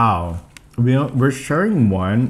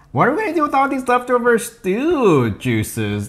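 a light abuela voice, pitched up; grandma voice; 6 semitones higher than usual. Retold or imitated. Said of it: "was like".

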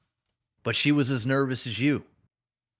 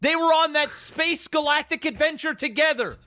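heartbroken lover's rant; 13 semitones higher than usual. Acted. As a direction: wail it at the game like a jilted lover who's done. Keeps ranting about the game.

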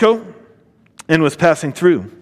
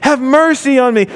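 higher, insistent plea; crying out again; 6 semitones higher than usual. A narrator's reading. Blind man crying out again and again, insistent plea. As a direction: keep crying out, refusing to stop. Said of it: "Again and again he cried out".

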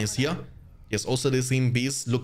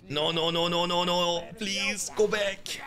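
real panicked pleading; 5 semitones higher, slower than usual. Real, not acted. Pleading with units to retreat.

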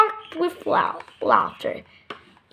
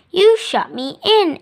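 grumbled; 5 semitones higher, 5 dB louder than usual. Acted. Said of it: "Ellie grumbled".

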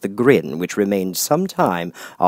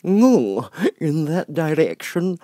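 grinning Cheshire Cat; 8 semitones higher, 5 dB louder, slower than usual. Acted. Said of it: "the cat said".

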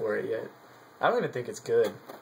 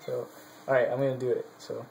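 ordinary talk

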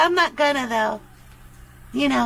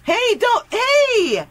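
high, raised-voice objection; alarmed protest; 5 semitones higher, 4 dB louder, slower than usual. Acted. Protesting over a new shirt, high outcry. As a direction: cry out in protest, pitched up.

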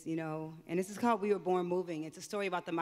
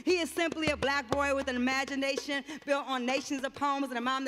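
rhythmic, raised performance poetry voice; spoken word poem; 8 semitones higher, 7 dB louder than usual. Acted. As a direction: recite it like a slam poet on stage.

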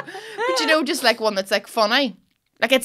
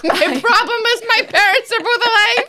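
high, loud mock-anguished whine, half laughing; mock their worry in a high, laughing voice; parroting a friend in exaggerated high-pitched distress; teasing mimicry; 11 semitones higher, 7 dB louder than usual. Mocking repeat of co-host's worry.

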